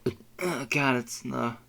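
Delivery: usual speaking voice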